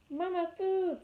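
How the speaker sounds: put-on high, tiny baby-bird cry for its mother; peeping gosling. A narrator's reading. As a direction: peep it out high like a newborn gosling. Gosling voice held.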